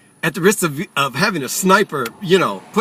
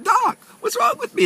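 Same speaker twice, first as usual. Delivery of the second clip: much higher frantic cry to the doctor; panicked plea. Retold or imitated. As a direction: beg the doctor in mock panic. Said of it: "So I'm panicking in the doctor's office".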